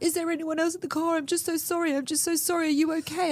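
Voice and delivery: high, tearful panicked voice; upset young driver. Retold or imitated. Crying young driver apologising after crash, high and panicky. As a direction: voice her rushing out sorry in shock, pitched high. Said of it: "She's like".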